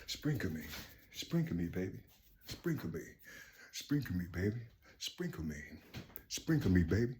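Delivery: very soft, low begging murmur; mock pleading followers. Followers begging their leader, very soft murmur. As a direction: beg softly to be sprinkled.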